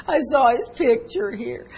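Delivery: a hint of a child's crying voice; imitated crying child. Granddaughter explains her tears to grandparent, lightly imitated crying. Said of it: "What made you start crying?".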